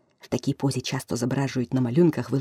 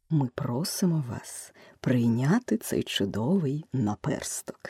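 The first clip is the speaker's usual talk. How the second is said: повільна низька церемонна промова; вручай як нагороду, низько й неквапно; урочисто; 4 semitones lower, 6 dB quieter, slower than usual. A narrator's reading. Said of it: "врочисто сказав". (урочистий низький голос, розмірено)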